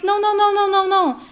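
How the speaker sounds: emphatic interrupting protest; insistent denial. A narrator's reading. Father cuts off daughter's protest.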